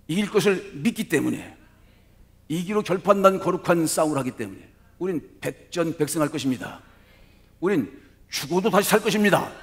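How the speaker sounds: loud rallying sermon cry; preaching at full force. Acted. (힘찬 설교조)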